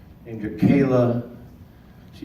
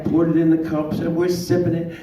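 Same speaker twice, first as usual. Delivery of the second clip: ordinary talk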